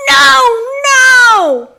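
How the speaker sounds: high, loud pleading noes; desperate call. Acted. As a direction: plead helplessly from the floor. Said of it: "Missus Dugan called from the floor".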